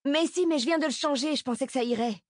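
high, insistent self-defence; pleading protest. Acted. Pupil defends herself to the teacher, protesting.

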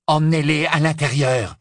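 loud, high icy order; cold command. Acted. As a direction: order them in coldly. Narcissa commands the snatchers.

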